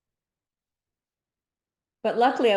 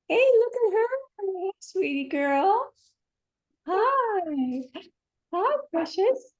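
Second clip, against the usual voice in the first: much higher cooing pet talk; 14 semitones higher, 3 dB louder, slower than usual. Real, not acted. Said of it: "my dog thinks I'm talking to him".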